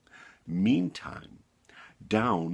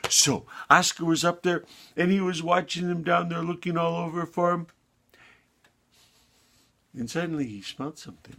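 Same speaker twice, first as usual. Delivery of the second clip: ordinary delivery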